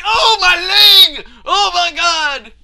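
high, loud mock agony; character yelling in pain. Player voicing heroine with crushed leg, mock pained yell. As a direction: wail it as a hammed-up injured heroine. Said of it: "and she's like".

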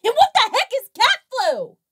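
loud, fast, high disbelief; baffled outburst. Real, not acted. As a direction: blurt it out in total disbelief. Baffled outburst at movie plot.

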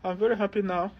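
high, hollow fake-cheerful reply; forced cheerfulness. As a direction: pitch it up like a guilty person pretending all is fine. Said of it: "you see how the face is dead".

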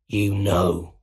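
creepy villain voice; sinister voice inside someone's head; sinister Goblin. Acted. Goblin answers Osborn's question, sinister.

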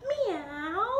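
high, swooping playful meow; long high cat call. Pretend. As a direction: meow like a cat for small children. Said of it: "like a cat".

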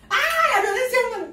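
real excited yell. Real, not acted. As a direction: yell it high like a scandalized fan. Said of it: "la estoy enseñando a gritar como yo".

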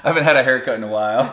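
fast giggly talk; giggly laughing. Real, not acted. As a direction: laugh through the words. Laughing through line.

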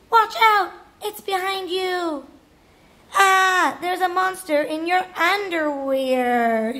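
high, urgent cry of warning; alarmed warning. Acted. Rascal warns Luke and Violet of monsters, alarmed warning. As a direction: call out a mock-scared alarm.

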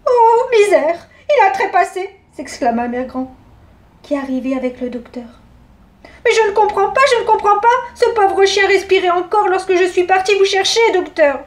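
a fussing granny baffled at the body; Mère Grand dismayed. Acted.

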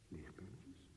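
breathy whispered question, rising at the end; a soft incredulous whisper; whispered echo. Acted. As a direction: breathe the word back to her, unsure. Echoing her word in disbelief.

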